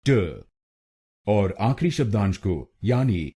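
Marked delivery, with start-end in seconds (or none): 0.1-0.4 ट said alone as a short tuh; ट as a quick unstressed tuh; syllable tau. Shown as a demonstration. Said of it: "टीएयू हम बोलेंगे".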